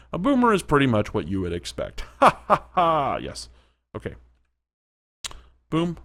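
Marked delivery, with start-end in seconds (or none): 2.2-3.2 short burst of real laughter. Real, not acted. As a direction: laugh out loud at it. Laughing at the boomer.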